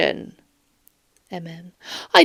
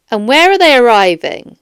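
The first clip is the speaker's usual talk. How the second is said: ordinary delivery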